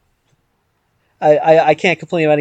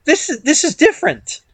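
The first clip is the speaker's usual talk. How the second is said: retold surprise; 8 semitones higher than usual. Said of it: "that time when I skipped ahead".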